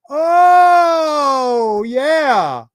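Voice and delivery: high, loud cry of realisation; shocked cry. Real, not acted. Seeing himself at the towers, loud shocked cry. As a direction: gasp it out in disbelief. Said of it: "reacting to photo".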